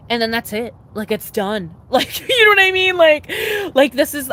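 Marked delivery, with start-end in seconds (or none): 1.9-4.3 loud, high laughing outburst; real laugh, much higher and louder; laughing shout. Real, not acted. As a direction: burst out laughing at your own fear. Laughing about turning twenty-five.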